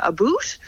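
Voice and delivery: "a boot" with a long oo vowel; mistaken Canadian "about". Shown as a demonstration. Actor mimicking what everybody thinks Canadians say, oo-vowel "a boot". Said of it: "everybody thinks Canadians say".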